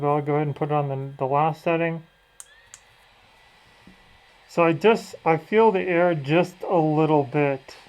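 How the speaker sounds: muffled speech through a fan mask; through a face mask. Real, not acted. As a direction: talk from inside a covering mask. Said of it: "I have the mask on my face here".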